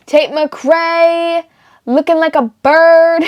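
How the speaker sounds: high, loud runway announcer; sleek fashion show hype. Real, not acted. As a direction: announce her like a runway host. Announcing next look, hype.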